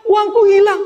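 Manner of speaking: put-on high, whiny little-child voice; whining child. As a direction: whine like a small kid who lost his money. Child crying to father.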